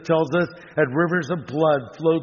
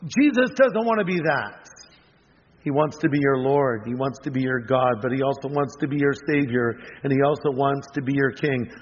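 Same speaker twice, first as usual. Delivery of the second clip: normal talking voice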